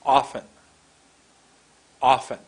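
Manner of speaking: Often punched loud on a wide open first vowel; Often, loud first syllable, falling away; wide-open mouth. Shown as a demonstration. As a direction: open wide and hit the start of Often hard. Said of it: "I open to sixty percent".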